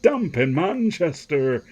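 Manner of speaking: slow, high, exaggerated Manchester attempt; badly faked Mancunian, drawn out; failed Manchester accent. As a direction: have a poor go at Manchester. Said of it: "I can't do a Manchester accent".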